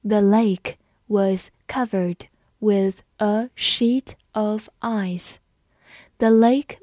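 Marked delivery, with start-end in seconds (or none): 0.1-5.4 word by word, with clear gaps; slow read-along. Shown as a demonstration. Teacher leading students through the lake sentence, slow read-along. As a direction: read it slowly for learners to follow. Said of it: "跟着我慢读一遍".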